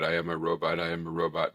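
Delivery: low, quiet robot monotone. Shown as a demonstration. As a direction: drone it like a robot. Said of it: "sounding like a robot".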